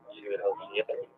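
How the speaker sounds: normal talking voice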